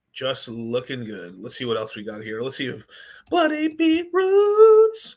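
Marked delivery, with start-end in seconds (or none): 3.3-5.2 silly high voice. Pretend. Announcing a coin name in a silly voice.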